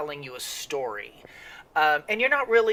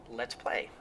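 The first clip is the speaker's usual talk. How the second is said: soft whisper, let's play; whispered sign-off; 10 dB quieter than usual. Real, not acted. Host whispers his catchphrase to start the game, hushed. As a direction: breathe it out, barely voiced. Signing off intro.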